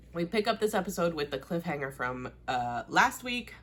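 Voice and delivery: ordinary talk, nothing marked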